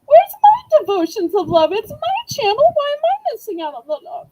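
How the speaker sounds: high, loud playful whine of protest; hammed-up wounded outcry; mock indignation. Playfully protests being left out.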